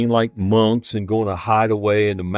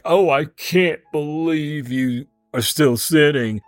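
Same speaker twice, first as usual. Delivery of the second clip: judgmental; 5 semitones higher than usual. Pretend. Judging look at sinners.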